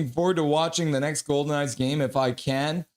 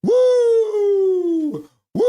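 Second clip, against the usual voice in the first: much higher, louder whoop; celebratory whoop; 7 dB louder than usual. Real, not acted. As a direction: cheer at the top of your voice. Celebrating the wave.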